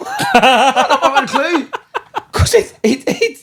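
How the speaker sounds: loud, slow laughing admission. Real, not acted. Admitting he didn't know [name], laughing. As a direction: laugh it out loudly and slowly. Laughing at his own story.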